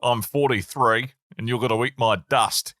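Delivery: put-on Australian accent; cocky imitation Australian. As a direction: do a mock Aussie boast. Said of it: "I can do that accent".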